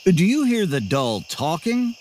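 ordinary delivery

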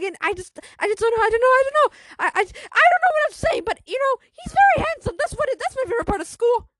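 high, loud, rushed, stammering confession; acted panicked rambling. Anxious girl explaining her crush to friends, panicked babbling. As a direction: babble frantically, tripping over the words.

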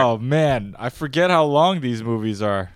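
loud exclaim. Real, not acted. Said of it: "reacting to the long plot".

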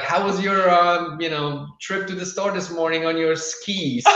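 high, teasing sing-song; mock teasing. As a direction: needle him playfully about the snow. Family teasing him about snow.

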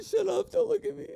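high, teasing, embarrassed; bashful mock protest; playfully embarrassed. Real, not acted. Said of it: "I'm gonna cry right now".

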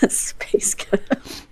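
slow breathy whisper of it. Shown as a demonstration. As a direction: whisper it slowly. Whispered repeat of the joke.

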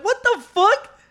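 high, loud incredulous what the fuck; mock outrage at a gas charge; retold shock. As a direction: squeal it in disbelief. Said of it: "I'm like".

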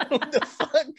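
high, slow, laughing. Real, not acted. Host laughing at the yawning co-host, giggling. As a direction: squeeze the words out through laughter.

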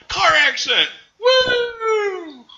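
very high, loud, whooping cheer; gleeful shout. Retold or imitated. George finding his crashed car, cheering with a very high, loud whoop. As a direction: cheer it like the best news ever. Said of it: "he's like".

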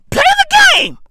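high, loud comic squeal; squeaky, worked-up command; exasperated urging. Acted. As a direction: yelp it at the complainers. Comic rant urging listeners on.

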